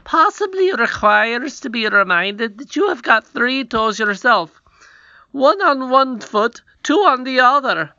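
high, loud mocking courtier; sly counselor voice. A narrator's reading. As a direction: needle the prince slyly. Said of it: "he said".

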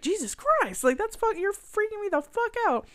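high alarmed mock yelp; freaked-out yelp. Friend retelling Channing Tatum's freaked-out reaction in a high yelp. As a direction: squeal like you're freaked out. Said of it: "he's just like".

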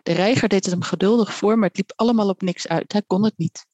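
ordinary delivery

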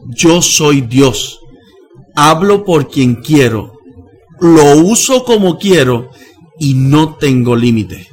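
slow, loud and solemn; voice of God. Retold or imitated. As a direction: proclaim it like God speaking through a sleeper. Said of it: "Me toca la frente y me dice". (voz de Dios, lenta, fuerte y solemne)

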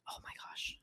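hushed, unvoiced whisper; secretive whisper before a story. Real, not acted. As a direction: whisper it like a secret you shouldn't tell. Hesitating over a risky story.

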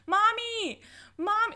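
high, mock-excited calling out for mommy; retelling a winner calling to mom after her speech. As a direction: lightly imitate her calling mom right at the end. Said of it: "she was like".

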